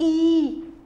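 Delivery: ई held long and level; long sound. Shown as a demonstration. Teacher answering whose sound is heard, ई drawn out. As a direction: sustain ई as one long vowel. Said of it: "double ई sound".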